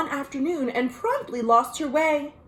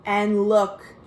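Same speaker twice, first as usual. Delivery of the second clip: ordinary delivery, nothing marked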